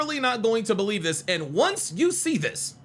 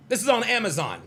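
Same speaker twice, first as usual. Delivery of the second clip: ordinary talk, nothing marked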